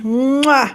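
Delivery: big smacking kiss sound; blown kiss. Real, not acted. Blowing a kiss in thanks.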